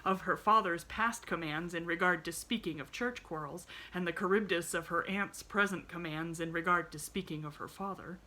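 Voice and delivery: usual speaking voice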